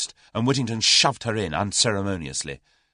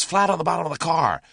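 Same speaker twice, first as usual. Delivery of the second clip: urgent cry; 8 semitones higher, 4 dB louder than usual. A narrator's reading. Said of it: "cried Julius".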